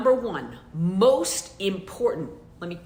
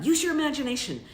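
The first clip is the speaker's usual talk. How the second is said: ordinary talk, nothing marked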